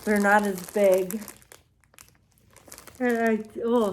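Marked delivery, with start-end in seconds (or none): 3.0-3.9 words through a yawn. Real, not acted. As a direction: talk while yawning. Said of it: "I'm yawning because".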